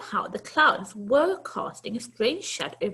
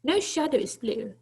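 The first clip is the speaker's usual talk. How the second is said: plain reading voice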